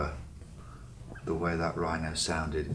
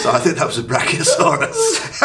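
laughing; 11 semitones higher, 14 dB louder than usual. Real, not acted. Joke after rhino noise.

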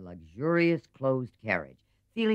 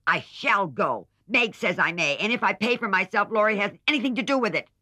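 put-on much higher, louder provoking child; aggravating; 11 semitones higher, 6 dB louder than usual. A narrator's reading. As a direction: needle her sister on purpose. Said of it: "in her most aggravating way".

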